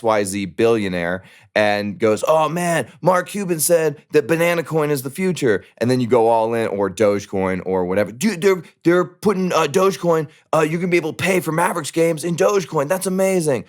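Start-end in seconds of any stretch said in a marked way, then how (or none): 2.3-5.6 high, mocking voice of a gullible fan; mimicking a fanboy who trusts billionaires; mocking a naive fan. 8.1-13.6 much higher, fast, gushing mock fan; overexcited bro, hammed up; mimicking a Dogecoin fan gushing about Mavericks games; hyped fanboy.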